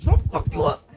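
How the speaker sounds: very high, strained threat; mock tense threat. Comic shows a tense shaking threat, strained high mock fury. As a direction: grit it out shaking.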